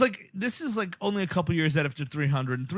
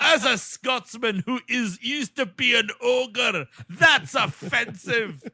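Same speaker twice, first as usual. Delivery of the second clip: mock offended ogre; 7 semitones higher, slower than usual.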